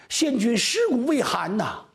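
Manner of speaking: high wailing lament; high keening grief; wailing widow. Acted. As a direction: cry it out to the ministers. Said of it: "哭啊".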